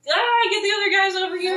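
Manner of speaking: high, loud call across the water; urgent shout to the other fishermen; calling out for help. Retold or imitated. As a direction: yell for the other boat to come. Fishermen calling for help, boat sinking.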